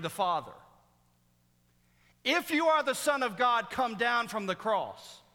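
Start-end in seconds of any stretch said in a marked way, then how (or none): 2.2-5.2 high, loud preacher's jeer; mocking taunt. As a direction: throw down the challenge in a raised voice. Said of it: "this word of mockery".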